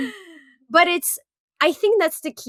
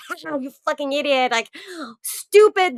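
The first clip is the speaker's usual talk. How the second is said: higher, mock-contemptuous sneer; mocking scorn; 5 semitones higher, 4 dB louder than usual. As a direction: scoff at a scared child, hammed up. Acted parent mocking a scared child.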